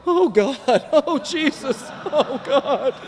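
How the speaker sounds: mock-horrified cries, half laughing; horrified gasping. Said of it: "watching him go".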